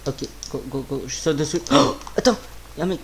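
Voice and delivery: normal talking voice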